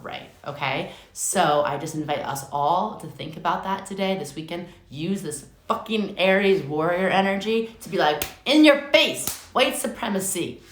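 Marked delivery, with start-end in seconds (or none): 4.9-10.6 a fired-up shout, higher and louder; rally them like a war cry; hyped rallying cry. Acted. Hyped call to defy racism.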